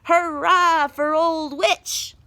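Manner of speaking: high, loud, slow cheer; whole town cheering the witch; crowd shout. A narrator's reading. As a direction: everyone bellowing it. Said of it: "shouted everyone".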